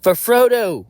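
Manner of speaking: loud, high rallying cry; battle yell. Acted. Deadpool drawing swords against orcs, battle yell. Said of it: "draw my swords and yell".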